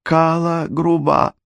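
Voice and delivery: high doll-like little voice; tiny doll voice, slow; breathy cutesy giggly. A narrator's reading. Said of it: "своим кукольным голоском произнесла Персефона".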